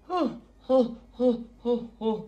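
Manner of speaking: high breathy huffs from a hot bite; puffing air over something too hot; mouth burning from hot food. Real, not acted. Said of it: "That's hot".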